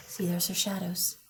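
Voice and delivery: whisper. Acted. Whispered aside to listeners.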